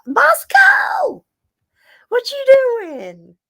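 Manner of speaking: high, loud call to the pet. Real, not acted. Streamer calling to her pet Bosco, loud high call. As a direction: shout across the room to Bosco.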